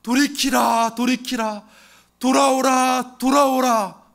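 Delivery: a preacher thundering God's plea to come back; booming call. Acted. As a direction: cry out the call to return with full force. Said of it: "반복, 반복합니다".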